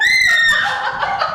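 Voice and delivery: high, loud shrieking laugh; loud burst of laughter. Acted. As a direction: cackle out at the teasing.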